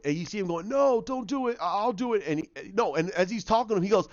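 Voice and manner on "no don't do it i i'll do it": pleading. Retold or imitated. Barry protests the sacrifice.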